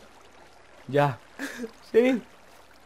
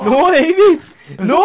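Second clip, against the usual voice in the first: higher, louder protest; urgent shout; 8 semitones higher, 7 dB louder, much slower than usual. Real, not acted. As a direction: shout to stop him. Stopping friend eating pig.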